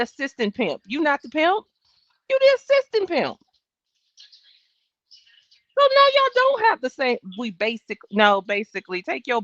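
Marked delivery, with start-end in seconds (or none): 2.3-3.4 "assistant pimp" squealed much higher and louder; mocking squeal. Mocking the witness, high and loud. 5.8-7.2 much higher and louder, scolding; heated retort. Real, not acted. Arguing back at the witness, high and loud.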